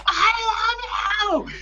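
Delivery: drawn-out, very high mock scream; imitated scream. Fan contrasts idol's farewell with his own, high mock scream. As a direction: stretch it into a slow high squeal. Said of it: "she screams at the top of her lungs".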